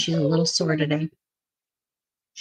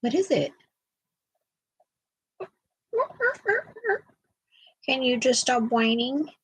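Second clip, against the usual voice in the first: soft pet talk; 5 semitones higher, 5 dB quieter than usual. Real, not acted. Fussing over the whining dog.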